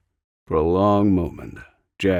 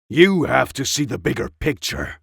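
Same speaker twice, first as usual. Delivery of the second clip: pushed higher and louder in a heated argument; 5 semitones higher, 4 dB louder than usual. Acted. Jack arguing with Anna to leave Cairo, heated. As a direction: press the point hard, heated. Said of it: "Jack said heatedly".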